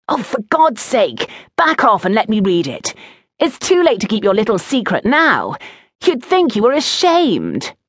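high-pitched, exasperated jeering; taunting schoolboy. A narrator's reading. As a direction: needle your friend to hand it over. Oakenrot taunts Vander over poem.

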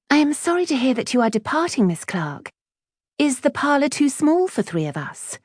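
high-pitched, prim retort; high schoolgirl voice, coolly civil; polite, needling Betsy. A narrator's reading. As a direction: answer back with pointed politeness. Voices Betsy answering back politely.

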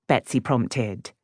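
ordinary delivery, nothing marked

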